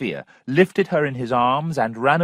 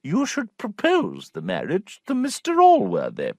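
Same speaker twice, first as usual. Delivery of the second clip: higher, knowing aunt voice; the prim sister confiding to her brother; 8 semitones higher than usual. A narrator's reading.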